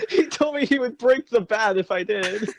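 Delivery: ordinary talk, nothing marked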